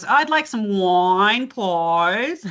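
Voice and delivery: slow plummy Aussie "wine, please"; mock-refined friend ordering; mild posh Australian accent. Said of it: "a friend of mine will go out".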